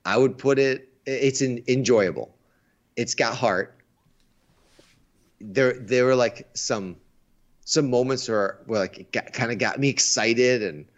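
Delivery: ordinary talk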